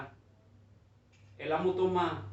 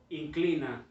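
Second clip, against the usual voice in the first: ordinary talk, nothing marked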